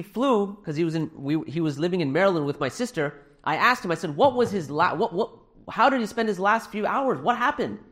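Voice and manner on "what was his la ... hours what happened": raised pitch, pressing questions; urgent questioning. Retold or imitated. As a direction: ask as if needing to understand what happened. Retold urgent questions after father's death.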